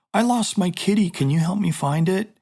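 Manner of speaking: lightly acted pleading stranger, pitched high; demo stranger's lure. Shown as a demonstration. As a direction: play-act asking a child for help. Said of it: "a pedophile would use to try to lure a child".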